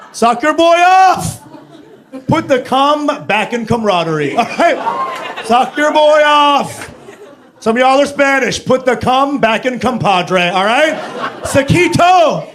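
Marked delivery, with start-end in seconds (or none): 0.1-1.4 loud, slow, high declaration; slow shouted rallying call; shouted punchline. Acted. 5.4-6.9 slow laughing shout; slow shouted punchline. Acted. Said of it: "repeated punchline". 11.4-12.5 loud, slow, high single word; slow laughing shout; shouted punchline. Acted. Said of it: "punchline tag".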